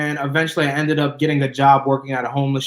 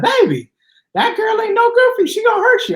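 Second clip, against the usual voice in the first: much higher, motherly warning voice; mom's voice; 4 dB louder than usual. Retold or imitated. Mother warning her son off his girlfriend, high motherly voice. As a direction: warn him like his mom from Zebulon. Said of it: "she's like".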